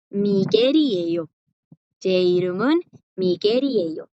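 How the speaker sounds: "Miguel y ello" joined, the final L sounding as a tapped R; R linked to I. Shown as a demonstration. Teacher repeats for students, "Miguel y ello" linked with R. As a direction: carry the end of "Miguel" into "y" as "ri". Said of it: "Que esta R suene con la I que sigue".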